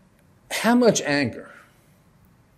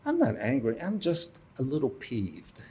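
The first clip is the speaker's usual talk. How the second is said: soft, sheepish downplaying; mock innocent excuse to the wife; mock sheepish denial; 9 dB quieter than usual. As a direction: play it down, sheepish and much softer. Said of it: "So how do I reply?".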